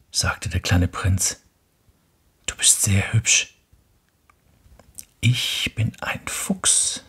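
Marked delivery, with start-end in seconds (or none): 2.4-7.0 whispered, slow; fox whispering. Acted. Fox speaks from under the apple tree, whispered. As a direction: murmur it shyly from under the tree. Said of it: "sagte der Fuchs".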